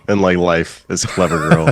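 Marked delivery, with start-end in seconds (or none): 1.1-1.7 high, put-on voice for the famous line; movie quote impression. Quoting the line he uses most in life, in a high put-on voice. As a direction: lift into a borrowed movie-hunter voice.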